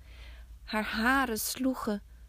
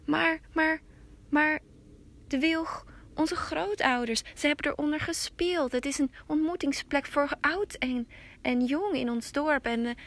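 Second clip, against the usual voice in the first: higher, stammering protest; pleading Hetaro; 6 semitones higher, 4 dB louder than usual. Acted. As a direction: beg to save the beloved tree. Hetaro protests for his willow.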